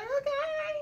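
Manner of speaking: a light high reluctant okay; high, soft okay. Retold or imitated. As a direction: say it high and hesitant. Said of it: "she's just like".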